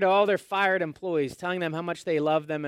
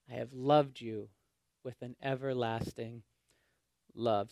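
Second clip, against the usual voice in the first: softer, tender, fading to almost nothing; soft tender declaration; 6 semitones lower, 7 dB quieter than usual. Acted. Pastor quoting God to the refusing exiles, hushed. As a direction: let it sink to a hush. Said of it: "God says".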